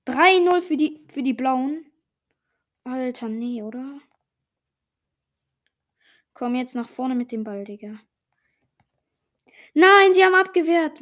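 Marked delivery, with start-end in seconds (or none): 0.0-1.9 loud exclamation. Real, not acted. Score announced loudly. 9.7-11.0 loud, high dismayed shout; frustrated shout. Real, not acted. Shot saved.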